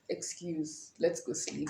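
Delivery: very soft, drained mumble; barely bother to say it; tired mumble. Retold or imitated. Tired teen at the dinner table, soft drained mumble.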